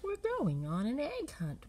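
usual speaking voice

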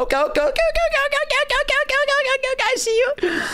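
high, frantic string of okays; panicked chatter. Real, not acted. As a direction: babble it in a squeaky panic. Panicked rapid okays in a fight.

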